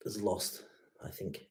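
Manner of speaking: whispered, trailing off; mock-mournful whisper. Real, not acted. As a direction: whisper it like a sad secret. Mock lament over rugby.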